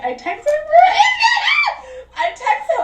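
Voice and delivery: high, cracking up while talking; can't keep a straight voice; laughing. Real, not acted. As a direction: laugh through the words, pitch shooting up. Laughing while retelling her story.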